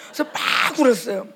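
ordinary talk, nothing marked

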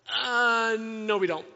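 loud, drawn-out "uh" before a frank denial; blunt honesty. Acted. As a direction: imitate a puzzled disciple owning up, loudly. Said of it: "I love the honesty of the disciples".

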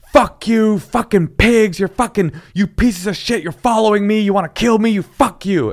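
high, loud, furious paranoid rant; irate caller. Acted. Interpreter voicing an irate caller to police, furious rant. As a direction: rage down the phone at the police. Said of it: "irate, you know, the guy".

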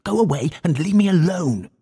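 high, frantic outburst; shouted. A narrator's reading. Clift driving the visitors away, shouted. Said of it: "he shouted".

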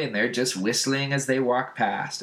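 ordinary talk, nothing marked

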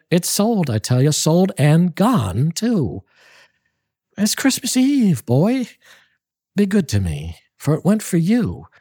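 begging him to forgive her, voice soaring high; cried out pleading. Acted.

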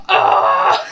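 high, loud comic "Ah!"; mock inner scream. Student watching mom stare down teacher, mock scream. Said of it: "in my head, I was like".

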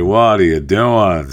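usual speaking voice

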